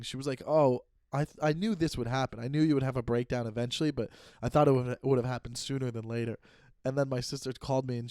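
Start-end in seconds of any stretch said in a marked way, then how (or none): none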